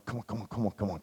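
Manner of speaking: impatient hushed mutter under the breath; a quiet, fretful urging of the elevator; anxious. Retold or imitated. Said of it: "I was pretty anxious".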